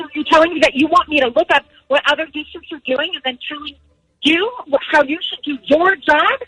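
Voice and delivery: ordinary delivery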